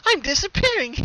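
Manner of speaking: high delighted cartoon pony; giggly pony. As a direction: cheerfully announce she's fading. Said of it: "she laughed".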